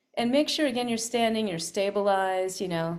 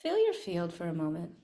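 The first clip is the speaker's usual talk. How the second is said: guided meditation; 2 semitones lower, 6 dB quieter than usual. Acted. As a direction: guide them inward, softly. Leads a meditation.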